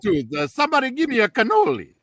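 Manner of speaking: high, fast cartoon Italian voice; Mario voice. Guest asks for a cannoli in a high, fast Mario voice. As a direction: beg for a cannoli like the game's plumber. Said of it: "That's pretty good ... more than Chris Pratt".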